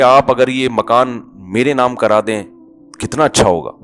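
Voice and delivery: softly coaxing, honeyed request; sweet-talking wife. Retold or imitated. Wife softly coaxing husband to put the house in her name. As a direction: sweet-talk your husband into signing over the house. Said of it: "بڑی محبت سے کہیں گی".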